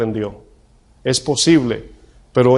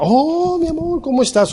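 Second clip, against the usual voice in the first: much higher, put-on gushing voice; fake sweet greeting. Preacher faking a greeting to his wife, put-on high voice. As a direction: greet a spouse in a phony high coo. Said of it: "cambie toda mi actitud".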